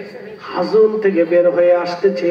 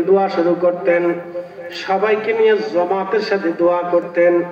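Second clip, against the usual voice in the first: ordinary talk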